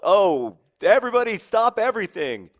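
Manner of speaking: sarcastic kid mocking a daily parade; mock excited announcement. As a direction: call everyone to halt in mock panic. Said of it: "And I would be like".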